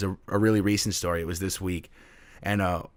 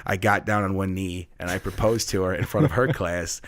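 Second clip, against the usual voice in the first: ordinary delivery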